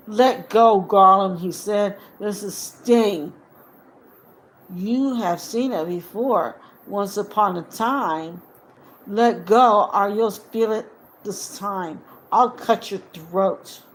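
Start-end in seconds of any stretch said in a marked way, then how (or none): 0.1-1.4 loud, commanding order; firm hobbit threat, loud; stern command. Acted. Frodo commanding Gollum. 2.2-13.8 threat. Acted. Frodo threatening Gollum.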